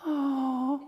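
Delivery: long, high cooing "ohh"; imitated crowd "aww". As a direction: mimic a congregation cooing over a dog. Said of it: "I heard a collective in this group go".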